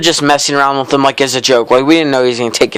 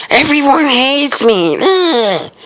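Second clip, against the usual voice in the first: much higher, slow whiny mock voice; mock a kid sulking that everyone hates him; hammed-up whine, much higher; whiny self-pity; 12 semitones higher, slower than usual. Mimicking classmate's self-pity.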